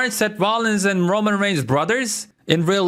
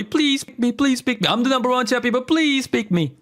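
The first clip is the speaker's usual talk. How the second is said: high pleading whine, imitating a begging wrestler; mock begging; 6 semitones higher than usual. Seth Rollins mock-begging Cody for a title match, high pleading whine. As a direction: beg like a desperate challenger, mocking. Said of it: "and begged, begged Cody".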